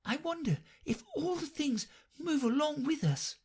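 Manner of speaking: a small puzzled thought to herself; puzzled Alice. A narrator's reading. Alice wonders to herself while running, high puzzled. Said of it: "thought poor puzzled Alice".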